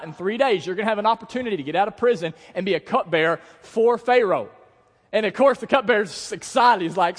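high, loud, driving sermon voice; full-force preaching. Real, not acted. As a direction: preach it to the back row.

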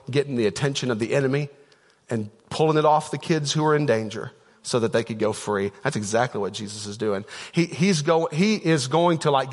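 ordinary talk

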